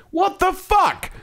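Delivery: high, slow incredulous "What the fuck?"; outraged disbelief. Real, not acted. Reacting to the mom's advice, incredulous. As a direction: drag it out in shocked disbelief.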